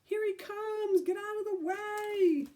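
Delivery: high panicked yell. A narrator's reading. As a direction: shout a warning while running.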